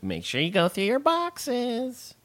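put-on high-pitched talking down to a baby; baby-talking parent. Mom babying her grown daughter, high baby talk. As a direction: coo it up high like to a toddler. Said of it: "treated like a little baby".

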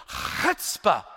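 chutzpah with a drawn-out, throaty Hebrew "ch"; Hebrew accent. Shown as a demonstration. Preacher naming the Hebrew word for faith, Hebrew accent. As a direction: scrape out the Hebrew opening of chutzpah. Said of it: "It's the Hebrew".

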